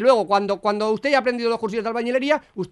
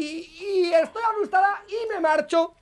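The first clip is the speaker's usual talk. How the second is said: mock whiny scared voice, higher and slowed; mocking imitation; 7 semitones higher, slower than usual. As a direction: parrot her excuse in a whimpering tone. Policía se burla imitando a ella. (imitación burlona llorosa)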